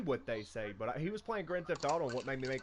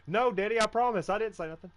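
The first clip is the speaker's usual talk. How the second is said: put-on higher, louder little-kid pleading; imitating pleading son; 6 semitones higher, 6 dB louder than usual. Son insisting to his dad, higher, louder kid voice. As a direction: beg like a kid insisting he's innocent.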